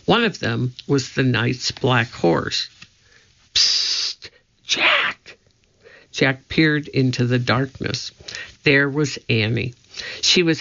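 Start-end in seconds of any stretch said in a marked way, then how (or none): 3.5-5.4 hushed, hissing "psst" whisper; secretive whisper to get attention; whispered call. A narrator's reading. Annie secretly calling Jack from hiding, whispered.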